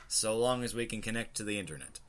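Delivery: ordinary talk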